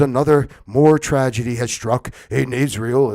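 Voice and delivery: loud, grave anchor voice; mock news anchor. Parodying the TV anchor reporting tragedy, loud and grave. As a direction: deliver bad news like a solemn anchor. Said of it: "He goes".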